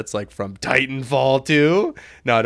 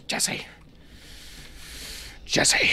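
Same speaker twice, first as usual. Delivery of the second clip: whispery, voiceless name-call; half-whispered character impression; Jesse; 7 dB quieter than usual. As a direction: hiss the name in a husky impression. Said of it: "my Jesse impression".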